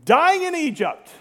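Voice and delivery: mock whine. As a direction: throw it out like a grumbler. Mock quote of complainers.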